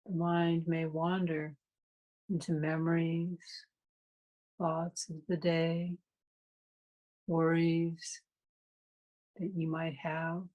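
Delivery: calm, unhurried meditation guide with long pauses; guided meditation. Real, not acted. Meditation teacher leading a class into breath awareness, calm guided meditation. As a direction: guide listeners through the breath in spaced-out, even phrases.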